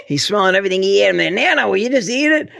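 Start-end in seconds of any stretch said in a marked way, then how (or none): 1.3-2.5 exasperated teasing. Retold or imitated. Teasing friend at dinner table.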